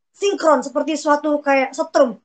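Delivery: normal talking voice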